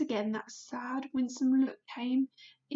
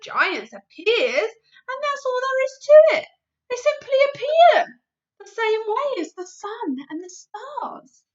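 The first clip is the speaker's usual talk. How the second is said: higher, louder giant declaring; BFG, insistent; 10 semitones higher, 10 dB louder than usual. Acted. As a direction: proclaim it as a giant. BFG voice in story.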